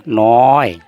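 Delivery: नौए said loud, with an added e glide at the end; Bengali noe. Shown as a demonstration. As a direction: say नौए as no-eh, firmly. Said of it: "उच्चारण करेंगे नौए". (बंगाली उच्चारण नौए, ज़ोर से)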